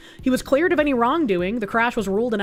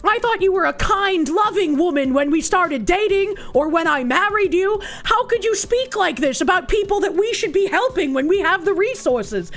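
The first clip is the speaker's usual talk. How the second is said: mocking cuck husband; 6 semitones higher than usual. Streamer mocking the TV husband, higher pleading. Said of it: "he's like".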